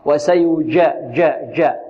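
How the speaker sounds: pure ج in وسيجا from mid-tongue, no d; correct jeem. Shown as a demonstration. Teacher modelling the right jeem for students, clean mid-tongue sound. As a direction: keep the tongue tip down for a clean جا. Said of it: "طرف اللسان يبقى تحت".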